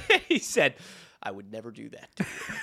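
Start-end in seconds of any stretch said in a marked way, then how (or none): 1.2-2.5 low, softened mock-earnest quote; hushed retold denial. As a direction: drop low and quiet for the quote.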